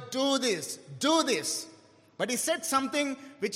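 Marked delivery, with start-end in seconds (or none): 0.1-1.7 "Do" hit hard each time, falling; stress on DO. Shown as a demonstration. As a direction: punch "Do this" twice. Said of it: "emphasized on the word".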